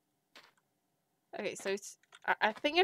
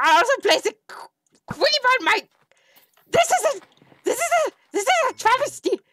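outraged rant; 10 semitones higher, 4 dB louder than usual. Real, not acted.

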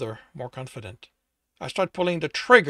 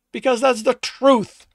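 loud, slow, higher shout; angry insistence; 11 semitones higher, 10 dB louder than usual. Acted. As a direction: hammer each word home. Hunter insisting to demon.

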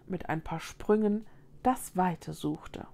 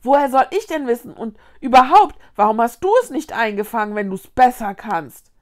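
loud, fast, indignant comeback; heated defensive outburst at speed; quarrelling retort; 3 semitones higher, 10 dB louder, faster than usual. A narrator's reading. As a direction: fire back angrily. Acted quarrel, Vicky hits back.

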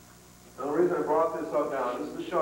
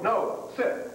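firm command; 6 semitones higher than usual. Shown as a demonstration. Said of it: "if he moves, we're gonna go".

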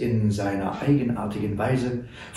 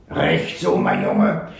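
put-on old trapper; 11 semitones lower, slower than usual. A narrator's reading. Old Sam praises the boy.

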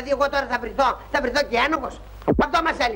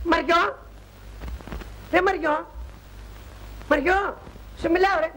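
raised voice, calling her name again and again; 6 semitones higher than usual. Acted. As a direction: call across the room to get her attention. Calling the girl repeatedly. (φωνάζει δυνατά το όνομα)

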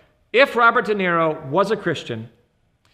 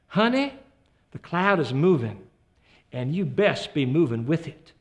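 put-on Southern accent; 3 semitones lower than usual. The Lord answering a praying Southern wife, in a Deep South accent. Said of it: "the Lord says to her in this accent".

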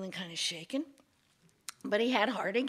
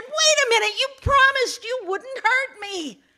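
alarmed protest; 13 semitones higher, 11 dB louder than usual. Acted. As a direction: cry out in betrayed alarm. Betrayed man protests to snake.

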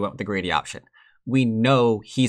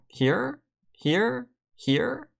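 rising questioning; 12 semitones higher, much slower than usual. Real, not acted. Rising pitch on each here.

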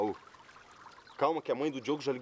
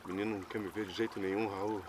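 sad; 5 semitones lower, 7 dB quieter than usual. Acted. Dejected man confides in friend.

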